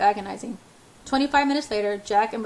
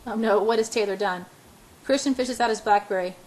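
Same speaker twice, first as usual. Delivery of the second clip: ordinary delivery, nothing marked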